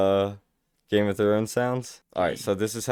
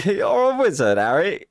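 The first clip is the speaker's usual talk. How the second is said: laughing echo; 8 semitones higher, 3 dB louder than usual. Pretend. Echoing friend's Hagrid line laughing.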